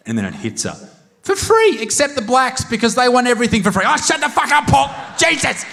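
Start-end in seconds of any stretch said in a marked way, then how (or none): 1.2-5.6 high, blurting mock outburst; tic-stricken outburst. Woman losing control mid-announcement to tics, high blurting. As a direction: proclaim high, then blurt helplessly. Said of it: "the Tourette start playing".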